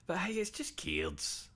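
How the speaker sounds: slow, high, airy dismissal; breezy shrug. Real, not acted. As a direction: brush it off lightly. Shrugging off the card shortage.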